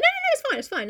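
very high, hurried mock reassurance; flustered reassurance. Kathy telling Edgar he isn't early, flustered. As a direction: wave it away in a fluster.

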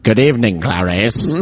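lightly voiced menacing film villain; a hint of the actor's greeting; Anthony Hopkins. Host playing villain greeting Clarice, mock impression. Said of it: "an Anthony Hopkins impression".

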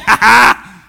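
loud taunting "ha ha"; mock gloating laugh. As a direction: laugh right in their face. Gloating after mocking quote.

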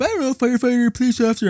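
put-on very high childish voice; typical kid answer. A child answering the grown-up, high childish voice. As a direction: answer like a shrugging little kid. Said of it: "what do you wanna be".

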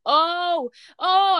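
high, excited gasping oh; re-live the shock of spotting it; freaking out. Retold or imitated. Fan seeing the dark saber appear, excited high oh. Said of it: "I was freaking out".

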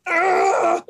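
sharp high yell of rage; angry yell. Acted. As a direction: burst out screaming. Outburst of rage.

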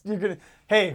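usual speaking voice

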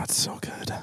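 hushed gossipy whisper. Real, not acted. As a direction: whisper it like a guilty secret.

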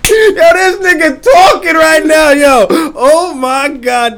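high laughing yell; laughing shout. Real, not acted. Cracking up at co-host.

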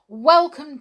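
loud, high royal greeting; booming welcome from the king and queen; cried welcome. A narrator's reading. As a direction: call it out gladly to the heroes. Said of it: "they cried".

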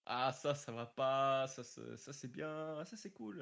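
acted inner verdict, loud and slow, rising to delighted; judging own work. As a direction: play yourself rehearing your track and weighing each bit. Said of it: "tu fais".

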